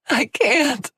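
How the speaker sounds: high, loud gasping cry; gasped. Acted. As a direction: gasp it out, unable to breathe. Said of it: "she gasped".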